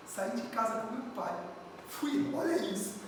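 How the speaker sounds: normal talking voice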